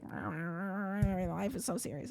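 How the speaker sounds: low, slow, drawn-out mock moan; mock over-serious whining. Streamer mimics a man who takes things personally, mock over-serious. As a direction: drag it out, soft and low. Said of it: "He always takes things so seriously".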